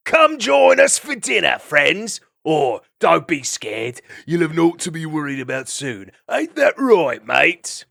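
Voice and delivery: mocking call. Vermin luring two travelers to dinner, loud and mocking. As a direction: taunt the prey with fake friendliness. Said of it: "calling mockingly".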